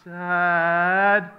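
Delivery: put-on drawn-out child's call, swelling on the second; little boy calling. Little boy calls his father from bed, insistent child's call. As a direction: stretch out the word like a nagging kid. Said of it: "suddenly a little voice comes up".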